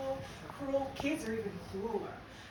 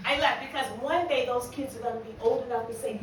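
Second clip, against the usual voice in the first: ordinary delivery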